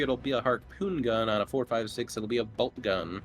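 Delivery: ordinary delivery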